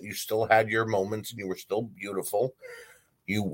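normal talking voice

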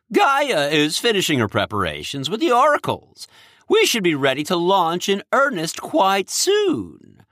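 hint of an eager king; lightly voiced Cronus. Cronus character voice.

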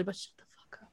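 breathy whispered telling-off; hushed mock scold. To teasing friends, a whispered mock scold. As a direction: hiss it playfully. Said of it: "I'm like".

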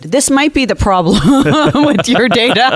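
can't stop laughing mid-joke; laughing through it. Real, not acted. Joking about whiskey at 8am.

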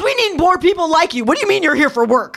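loud, high, incredulous rallying; hard-driving coach. Retold or imitated. Daughter imitating dad challenging a workaholic, coach-like shouting. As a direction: bellow it like an imitated team coach.

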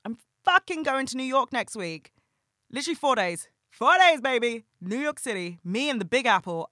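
high, loud gleeful announcement; thrilled. Real, not acted. Telling listeners about her New York trip, high and thrilled. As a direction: burst with excitement about the trip.

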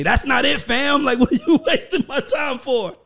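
imitating the mentor shooting down his tape; mentor brushing him off. Mentor scolds young Ray for wasting his time, mock dismissive laugh.

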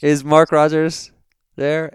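high, slow, loud prissy question; put-on phone voice. Pranking the Amazon caller with a high, slow put-on voice. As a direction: ham up the prim stranger. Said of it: "I did the voice".

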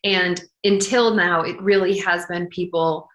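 ordinary talk, nothing marked

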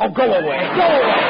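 exasperated shoo. Acted. Shooing off a pest.

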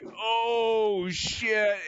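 high, stretched-out "uh-oh" moment, lightly acted; mock dread. Junior crewman hearing the controller's accusation, mock dread. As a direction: draw it out high, caught in trouble.

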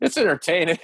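high laughing punchline. Retold or imitated. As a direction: crack up on the last word. Laughing punchline of retold quote.

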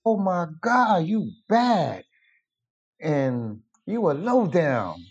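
high-pitched retold amazement, drawn out at the end; imitated admiring exclamation. Healer praises the designer's retouched pictures, imitated amazement. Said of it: "he was talking like that".

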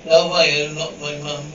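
normal talking voice

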